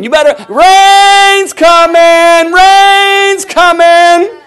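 very slow, high, loud drawn-out call; slow warning cry. Acted. Preacher as Noah warning of the rain, slow and loud. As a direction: hold every word like a final warning.

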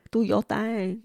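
normal talking voice

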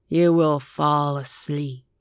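slow, steady, lulling hypnotist voice; a hypnotist droning the subject into sleep; hypnotic command. A narrator's reading. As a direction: lull him under with each word.

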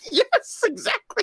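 very high, laughing squeal; laughing agreement. Real, not acted. Co-host agrees with host's inside joke, laughing high. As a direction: squeeze the words out through laughter.

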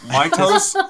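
squeaky high toes; excited. Real, not acted. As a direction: blurt it out excitedly. Excited joke about toes.

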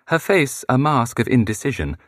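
usual speaking voice

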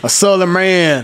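slow, put-on Southern drawl; Southern accent. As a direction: draw it out like a proud Southerner. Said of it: "I was digging the accent".

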